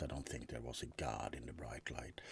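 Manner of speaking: quiet, soft whisper. Acted. Vampire whispering about death's light to roommate. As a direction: murmur it as a confession. Vampire recalls being turned.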